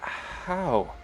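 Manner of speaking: a sick woman barely getting the word out; dying Arya asking Lenore, frail and soft; weak whisper-like. Acted. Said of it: "she just goes".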